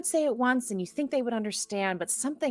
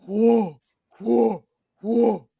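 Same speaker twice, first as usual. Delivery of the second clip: loud hooting triple call; creatures in one voice; 2 semitones higher, 9 dB louder, much slower than usual. Acted. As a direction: hoot it out loud, like a whole clump of critters. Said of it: "And in one voice".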